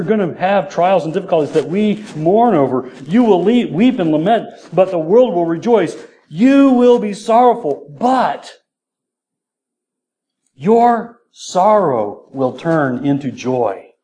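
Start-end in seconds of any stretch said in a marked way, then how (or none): 3.1-8.5 high, forceful reading building to a peak; emphatic scripture proclamation. Acted. Preacher reads Jesus's warning of sorrow, emphatic. 10.5-13.9 drawn-out, measured proclamation; slow right down and set each word apart; slow, weighty promise. Acted. Preacher delivers the promised turn to joy, slowly.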